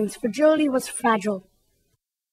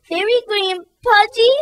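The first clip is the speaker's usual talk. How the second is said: high, hurt, wailing voice; 8 semitones higher than usual. Acted. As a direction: wail the words back in hurt disbelief. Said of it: "wailed Fragility".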